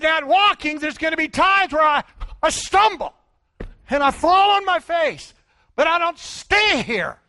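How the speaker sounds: loud, high, pounding sermon; fiery preaching. Acted. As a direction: preach it full force to the crowd. Preaching at full force.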